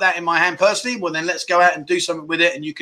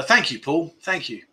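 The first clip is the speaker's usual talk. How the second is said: ordinary delivery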